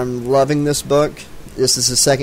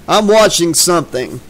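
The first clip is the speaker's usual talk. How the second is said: father's shout, only slightly raised; mild shout back; 4 semitones higher, 5 dB louder than usual. A narrator's reading. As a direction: call back from another room, understated. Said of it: "Kent's father shout back".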